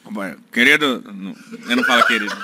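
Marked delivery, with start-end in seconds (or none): 0.0-1.0 Lula impression. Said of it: "tá uma mistura aí".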